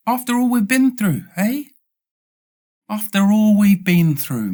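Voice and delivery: high, wounded plea to a friend; betrayed plea to cat. Retold or imitated. Pleading with the cat after it bit him, high and hurt.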